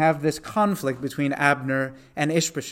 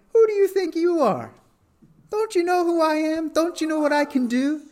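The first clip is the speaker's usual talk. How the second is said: much higher, haughty mock outrage; proud indignant person; 11 semitones higher than usual. Someone proudly putting down another in conversation, haughty mock outrage. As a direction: play the puffed-up bully demanding respect. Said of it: "that's the way it goes".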